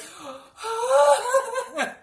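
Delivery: high, drawn-out mock gasp; sharp, high reenacted gasp breaking into laughter; horrified gasp. Son hears mom shout it in Home Depot, horrified gasp. His shocked reaction in the store.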